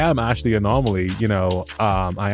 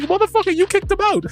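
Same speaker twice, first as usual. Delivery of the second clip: mock outraged. Thanos turning on the teammate who kicked his friend, high outrage. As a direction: squeal the accusation in disbelief. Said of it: "and it's like".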